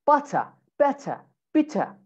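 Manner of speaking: British "butter, better, bitter", true T, no R; British accent. Shown as a demonstration. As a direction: end each word on "eh", British style. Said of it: "change it with the eh sound".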